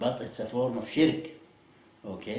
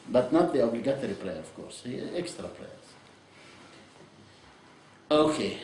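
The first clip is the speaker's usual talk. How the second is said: ordinary talk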